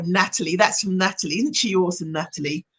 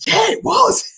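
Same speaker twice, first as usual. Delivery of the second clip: much higher, thrilled yeah it was; delighted surprise; 11 semitones higher, slower than usual. Retold or imitated. Client realising she was assertive, high delighted yeah. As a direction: sound amazed at yourself. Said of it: "And she was like".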